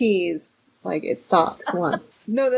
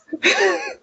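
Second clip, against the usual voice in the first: high laugh. Real, not acted. Laughs after joke.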